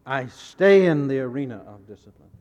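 loud, forceful preacher's quote; emphatic declaration. Acted. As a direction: belt it out as a vow. Preacher acting out Paul's determined declaration.

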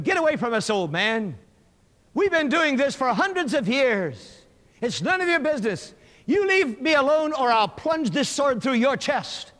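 loud, high gladiator's warning; angry threat. Acted. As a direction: threaten him loudly to back off.

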